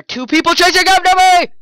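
high, loud panicked yell; being chased. Real, not acted. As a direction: cry out while fleeing. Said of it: "I'm getting chased right now".

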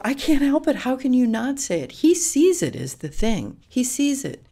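high, loud mock-exasperated retort; exasperated protest. Retelling an argument with partner about the pig, exasperated protest.

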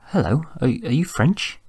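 high, halting, nervous stammer; hesitant question. A narrator's reading. Shy Doris addresses a handsome stranger.

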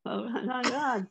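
high, drawn-out astonished gasp of words; mock amazement. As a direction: imitate stunned youngsters, stretched and high. Voices stunned young people on set.

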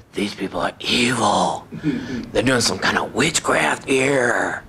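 low, slow, drawn-out ominous warning; voice in the head. Retold or imitated. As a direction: stretch it out like an eerie inner voice. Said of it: "I heard it clear as light".

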